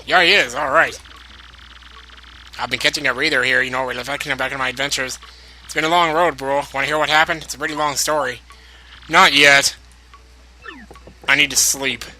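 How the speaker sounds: put-on light Italian accent as Luigi; a lightly voiced Italian plumber. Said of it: "now I got this whole Luigi thing to do".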